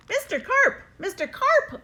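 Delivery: high, anxious call; boy yelling. A narrator's reading. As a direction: call out toward the pool. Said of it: "I yelled".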